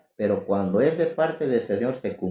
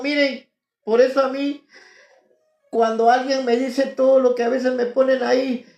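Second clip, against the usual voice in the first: high, loud impassioned preaching; fervent preaching; 11 semitones higher, 5 dB louder than usual. Real, not acted. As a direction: preach it at full force. Preaching at full force.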